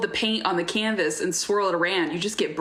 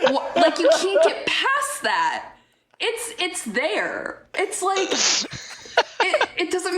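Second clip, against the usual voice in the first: laughing exasperation; 8 semitones higher than usual. Real, not acted. Ranting to cohosts about bad lyrics, laughing exasperated. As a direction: laugh out the outrage.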